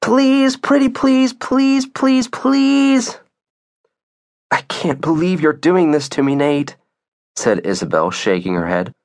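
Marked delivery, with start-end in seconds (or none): 0.0-3.2 put-on very high child's begging; pleading. A narrator's reading. Two children working on their mom, pleading. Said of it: "pleading with her". 4.5-6.8 higher-pitched wounded reproach; exasperated. Acted. Isabel reproaching Nate over the dog, exasperated.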